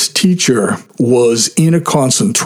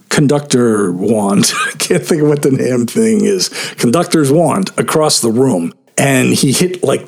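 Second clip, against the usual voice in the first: ordinary delivery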